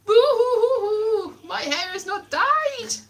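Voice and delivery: high, drawn-out fake crying; mock boo-hooing. Rejected actress going home from audition, mock crying. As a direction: wail a hammed-up boo-hoo. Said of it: "off I went and I was like".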